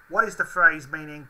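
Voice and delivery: ordinary delivery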